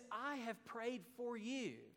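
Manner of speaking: slow, soft, "I" pressed first; stress on I. Shown as a demonstration. Preacher repeating Jesus' promise, "I" stressed slowly. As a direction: lean into "I" and slow the quote down. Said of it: "It's emphatic".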